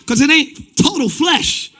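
loud steady pulpit delivery; firm preaching. Acted. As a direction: drive the point home to the church. Preaching at full force.

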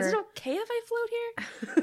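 high, laughing timid voice; high mock awkward girl; socially awkward. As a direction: ask shyly to join in. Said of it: "socially awkward".

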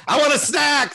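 put-on high, loud whiny kid demand; demanding daughter voice. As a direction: shout it like a bossy kid.

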